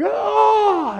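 long high-pitched wailing cry; drawn-out eerie wail; acted madman's howl; mad cry. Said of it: "a cry, a mad cry".

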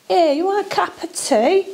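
put-on London accent. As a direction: send up a Londoner offering tea. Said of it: "That's how they speak, innit, in London?".

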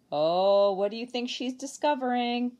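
usual speaking voice

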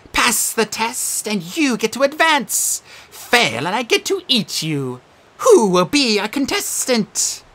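high, slow, sing-song menace; cheerful host with a threat; game show host. Acted. Sphinx as quiz host.

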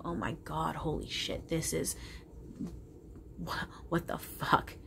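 half-whispered, stunned by the illusion; a hushed mock whisper of amazement; hushed awe. Awed reaction to the illusion.

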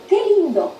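high, admiring exclamation; exclaiming. Shown as a demonstration. Teacher giving an example of exclamation marks, exclaiming. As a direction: exclaim it with a big lift in pitch. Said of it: "cuando uno exclama y dice".

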